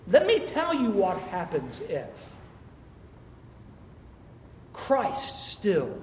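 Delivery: slow, steady, settling the fear; drawn out slow and sure; calm assurance. Acted. Preacher answers the panicked crowd with calm assurance.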